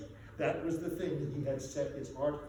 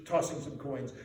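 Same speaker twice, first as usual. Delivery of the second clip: usual speaking voice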